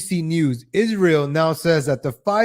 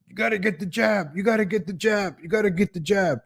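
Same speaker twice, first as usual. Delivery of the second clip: higher, softer singsong nagging; mock pushy urging; 4 semitones higher, 5 dB quieter than usual. Mocking the government nagging people to get the jab, higher and softer.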